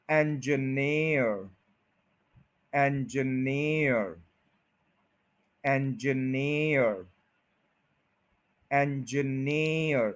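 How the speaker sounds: engineer said slowly with weight on neer; slow drilled engineer, stress at the end; stress on near. Shown as a demonstration. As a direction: stretch it out and lean on neer. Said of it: "near पे stress है".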